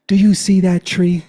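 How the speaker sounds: high, loud demanding question; commanding challenge. Retold or imitated. Boyfriend confronting his brothers at the door, loud challenge. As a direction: call it out like a challenge.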